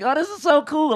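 high, delighted gush; high delighted reaction to the kids. Retold or imitated. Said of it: "I'm like".

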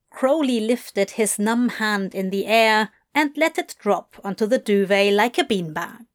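usual speaking voice

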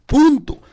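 PUNTO punched high and loud; emphatic full stop. Real, not acted. Teacher closing the accent rule for listeners, emphatic PUNTO.

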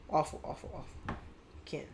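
ordinary talk

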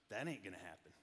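put-on low, quiet, flat teen refusal; deadpan teen son. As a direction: mutter it low like an unimpressed teenager. Son quoted flatly refusing.

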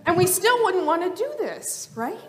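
ordinary talk, nothing marked

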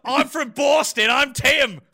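loud, high mocking Boston accent; exaggerated Boston accent, mimicking a friend. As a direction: shout a hammed-up imitation of a bad Boston accent. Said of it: "No, you're like".

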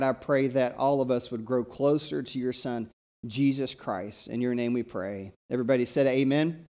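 normal talking voice

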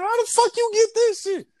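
shocked and caught out, up high; shocked question. Retold or imitated. Caught by his girlfriend, a high shocked question. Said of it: "I'm like".

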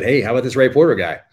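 eager pitch. Retold or imitated. Author writing the publisher about Ray Porter, eager and loud. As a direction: pitch the idea to the publisher with real excitement. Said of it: "I said".

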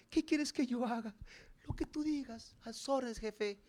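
high, soft, eager little servant voice; meek servant. Acted. Preacher voicing Saul as a meek servant to his boss, high and soft. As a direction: play a timid yes-man at his boss's orders.

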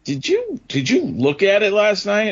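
high, incredulous mechanic; mechanic's question. Retold or imitated. Mechanic asking the owner, voiced high and incredulous. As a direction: mimic the mechanic's disbelief. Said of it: "And he's like".

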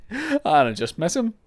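high, wobbly mock-tearful whine; put-on weepy voice; fake crying voice. Shown as a demonstration. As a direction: pretend to be about to cry. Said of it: "I said that like I was gonna cry".

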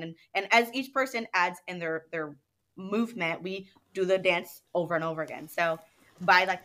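ordinary talk

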